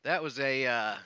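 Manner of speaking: ordinary talk, nothing marked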